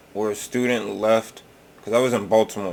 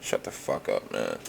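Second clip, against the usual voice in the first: much lower, dropped and quiet; a low growl to shut them up; low fed-up snap; 13 semitones lower, 7 dB quieter than usual. Pretend. Telling people who won't listen to shut up, low. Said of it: "and it's like".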